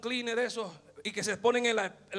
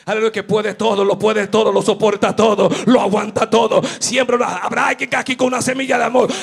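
loud, rousing sermon delivery; full-force preaching; 5 semitones higher, 12 dB louder than usual. Acted. Preacher urging the church to sow seeds of love, loud fervent preaching. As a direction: preach it hard, pushing every phrase.

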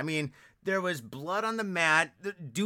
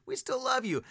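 higher reassurance; 7 semitones higher than usual. Acted.

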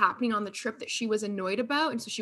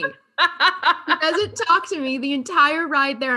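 laughing; 6 semitones higher, 6 dB louder, slower than usual. Real, not acted. Laughing retelling the silent ride.

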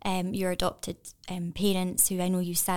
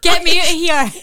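very loud, high comic shout; unborn baby calling out; 10 semitones higher, 17 dB louder than usual. Pretend. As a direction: yell to be let out, hammed-up and laughing. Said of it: "imagine myself inside the womb knocking".

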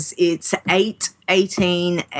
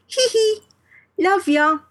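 Pixie voice; 12 semitones higher than usual. Retold or imitated. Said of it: "the high-pitched Pixie voice".

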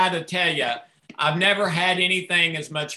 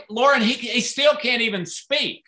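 ordinary talk, nothing marked

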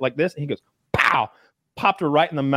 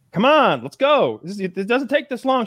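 impatient cop; 8 semitones higher than usual. Retold or imitated. Cop voiced in story.

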